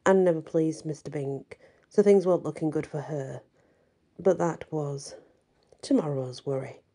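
ordinary delivery, nothing marked

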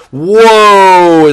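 long, high, loud whoa; amazed shout. Real, not acted. Gamer watching a fly ball soar, amazed shout. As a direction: holler it out, drawn long.

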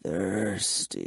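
low, soft, drawn-out vampire voice; slow, hushed undead murmur. A narrator's reading. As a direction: murmur it deep and hungrily. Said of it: "he murmured".

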